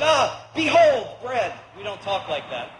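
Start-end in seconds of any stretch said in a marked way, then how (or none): 0.0-1.5 high, loud, slow "behold" as if in the King James; hammed-up old-time proclaiming in a grocery store; pompous Bible-speak over bread; mock archaic grandeur.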